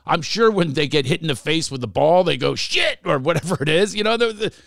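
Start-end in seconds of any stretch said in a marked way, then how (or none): 2.5-3.0 high-pitched mock exclamation; sudden cursing outburst. Host acting monk hit by volleyball, high startled curse. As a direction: yelp the curse as if just struck.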